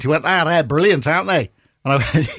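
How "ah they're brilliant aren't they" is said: put-on higher-pitched Manchester accent; Manc accent. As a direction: imitate an enthusiastic Mancunian accent. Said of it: "Manc accent".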